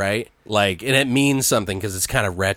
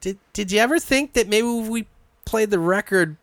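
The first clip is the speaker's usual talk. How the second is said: sheepish question; 11 semitones higher than usual. Retold or imitated. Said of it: "he's like".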